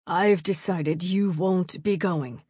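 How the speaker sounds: very slow, stern baron's voice; imitating the father. A narrator's reading. Narrator posing as baron to his daughter, stern. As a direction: pronounce it deliberately as the father. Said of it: "a voice that sounds just like her father's".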